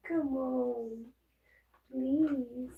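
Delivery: high, sing-song coaxing of a pet; high pet-talk voice pleading with the dog. Real, not acted.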